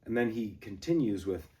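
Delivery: ordinary talk, nothing marked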